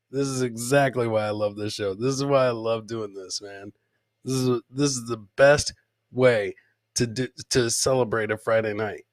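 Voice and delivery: usual speaking voice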